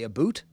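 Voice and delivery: "a boot" with a long oo, Canadian style; "a boot", the vowel turned to oo; Canadian "about". Shown as a demonstration. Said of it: "with a heavy Canadian accent".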